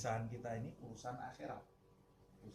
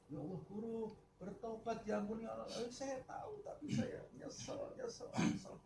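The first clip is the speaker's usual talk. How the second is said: high, whimpering lament fading to breath; hammed-up weeping; 10 semitones higher than usual. The teacher weeping over his mistake to the student, high and whimpering. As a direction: wail your regret, high and breathless.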